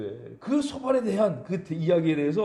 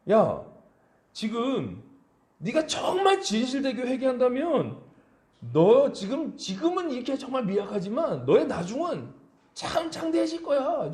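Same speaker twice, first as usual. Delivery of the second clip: lightly voiced friend, raised pitch, coaxing; Bildad's urging; 6 semitones higher than usual. A friend urging suffering Job, mildly coaxing. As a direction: nudge him gently to repent.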